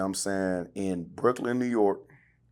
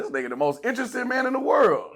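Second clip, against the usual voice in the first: much higher, louder amazed praise; hyped-up retold thought; excited praise; 5 dB louder than usual. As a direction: gush about him in awe. Retold awed thought about guest.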